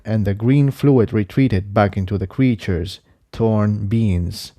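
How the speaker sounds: ordinary delivery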